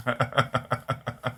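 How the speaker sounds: long run of quick chuckles. Real, not acted. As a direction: chuckle helplessly at a comment. Amused by viewer's comment.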